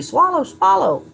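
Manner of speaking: slow high mock nurse instruction; nurse coaching. Nurse telling patient to swallow, slow and high. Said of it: "they tell you".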